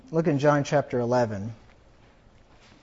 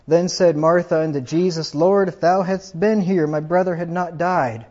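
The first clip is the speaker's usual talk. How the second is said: raised, louder preacher's reading of the gospel; scripture reading; 3 semitones higher, 6 dB louder than usual. Acted. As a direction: proclaim the verses to the congregation. Reads scripture aloud.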